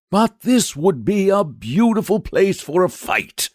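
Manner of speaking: high knight voice, coaxing; persuasive. A narrator's reading. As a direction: talk the dragon round sweetly. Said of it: "he began again persuasively".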